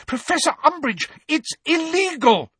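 put-on very high, alarmed girl's cry; urgent high-pitched outcry; shrieked protest. A narrator's reading. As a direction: shriek your objection, pitched right up. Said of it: "shrieked Hermione".

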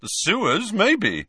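high, teasing jibe; scornful joking suggestion; snorting mockery. A narrator's reading. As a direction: land the jibe mockingly. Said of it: "said Ron with a snort".

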